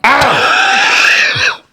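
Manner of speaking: high, startled shriek; sudden high-pitched yelp of shock; shocked scream. Real, not acted. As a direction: cry out in horrified disgust. Recoils at video of biting.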